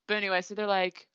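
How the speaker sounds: ordinary talk, nothing marked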